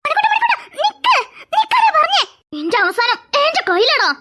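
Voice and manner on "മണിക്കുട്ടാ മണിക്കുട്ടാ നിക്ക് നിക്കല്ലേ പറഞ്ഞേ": shouting after him. Acted. Chasing Manikutta, shouting high for him to stop. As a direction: yell at him to stop, half laughing.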